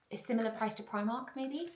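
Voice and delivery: ordinary talk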